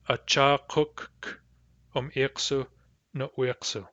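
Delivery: Acha kuk um iksu no iksu slowed, kuk held; slow model phrase. Shown as a demonstration. Teacher slowing the phrase with stacked Ks. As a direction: say Acha kuk slowly, doubled K. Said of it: "In Hadassa dialect, this is".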